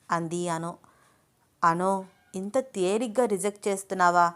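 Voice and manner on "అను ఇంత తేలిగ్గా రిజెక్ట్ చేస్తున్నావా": usual speaking voice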